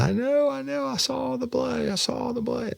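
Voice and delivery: high, thin imitation of a crying woman; imitated high-pitched distress; retold crying. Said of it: "And then she starts crying".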